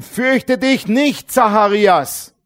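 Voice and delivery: slow, high, ringing proclamation; proclaiming angel. Acted. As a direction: stretch every word like a herald. Angel speaks, preached. (feierlich, langsam und hoch verkündet)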